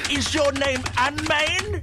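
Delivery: high, drawn-out daft questioning; mock lunatic. Imagining what a frantic typist would ask, mock lunatic voice. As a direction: put on a slow, oddball crank voice. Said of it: "someone who types like that".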